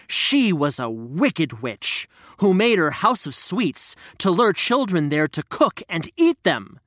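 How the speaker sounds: usual speaking voice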